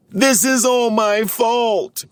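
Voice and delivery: high, slow, drawn-out storybook wail. A narrator's reading. Clumsy blames himself for the stranded Smurfs, high slow wail. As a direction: wail it like a guilty little Smurf. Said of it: "Clumsy wailed".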